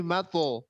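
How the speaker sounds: MATO with a heavy, rounded ending; thick echoed stop. Shown as a demonstration. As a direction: correct mato, t bounced dark and full.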